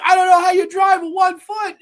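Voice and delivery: high, loud imitation; hammed-up high-pitched protest; mock frantic reply. Frantic reply in car chase.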